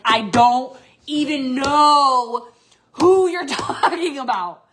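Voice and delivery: acted-out reply, loud and drawn out; exasperated insistence. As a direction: stretch each word loudly, half laughing. Acting out her emphatic reply.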